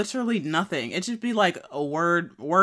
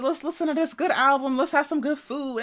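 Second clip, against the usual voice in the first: carefree stoner voice; 10 semitones higher than usual. Retold or imitated. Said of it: "I'm like".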